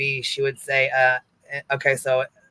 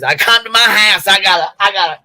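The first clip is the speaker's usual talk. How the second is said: high, loud panicked mimicry; mock panic; 6 semitones higher, 8 dB louder than usual. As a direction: imitate her freaking out, loud and high. Mocking panicked friend.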